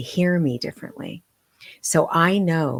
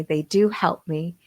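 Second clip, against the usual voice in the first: ordinary talk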